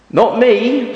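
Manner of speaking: a squeaky high goat's protest. Acted. Goat turning down Mister Gumpy, in a high refusing voice. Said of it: "says the goat".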